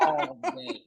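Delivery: high, giggly repeat; laughing. Real, not acted. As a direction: toss it off with a laugh. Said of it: "I would do this".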